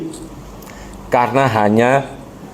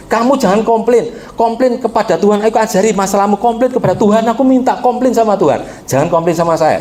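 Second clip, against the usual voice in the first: raised, preacherly urging; a husband pressing his faith on her; husband exhorting wife; 5 semitones higher than usual. Retold or imitated. Husband urging his barren wife to trust God, raised preacherly voice. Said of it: "Dia percaya".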